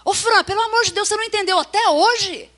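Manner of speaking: loud impatient reproach; exasperated scolding. Shown as a demonstration. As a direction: scold her in frustration. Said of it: "Não adianta você falar assim".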